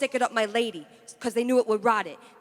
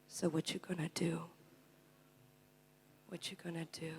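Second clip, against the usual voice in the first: low question, softer each time; fading to whisper; 4 semitones lower, 20 dB quieter than usual. Acted. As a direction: repeat it, vanishing into a whisper. Poem ending fades to whisper.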